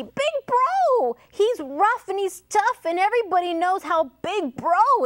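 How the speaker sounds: high, excited chimp voice; high-pitched, urgent chimp alarm; storybook chimpanzee. A narrator's reading. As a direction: rattle off the warning high and fast. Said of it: "said chimpanzee".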